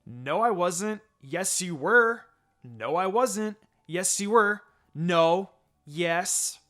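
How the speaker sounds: put-on two kids arguing, neither giving in; playful bickering. A narrator's reading. Children bickering back and forth.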